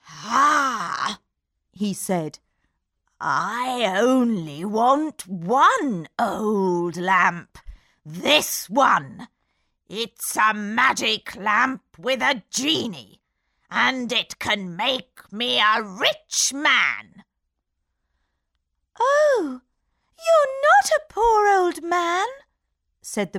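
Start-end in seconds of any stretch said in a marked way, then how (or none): 0.1-17.2 put-on slow, scheming old magician; a hint of villain; sly magician voice. A narrator's reading. 19.0-22.3 high, loud alarmed princess; gasping realisation; cartoon princess. A narrator's reading.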